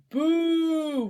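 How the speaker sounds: loud, long rising boo; crowd booing. Acted. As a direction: jeer from the stands. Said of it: "roared the crowd".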